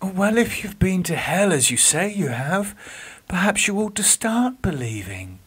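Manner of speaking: gentle nurse. Acted. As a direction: coax him toward faith.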